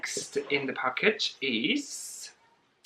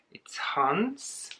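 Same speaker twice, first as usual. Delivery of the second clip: whisper; 3 semitones higher, 7 dB quieter than usual. Real, not acted.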